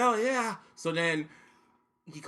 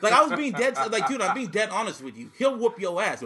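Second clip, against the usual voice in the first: ordinary talk, nothing marked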